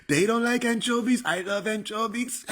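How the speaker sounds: high-pitched presidential caricature; Trump. Acted. Comedian as the president defending anchovies, Trump impression. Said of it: "Trump impression was spot on".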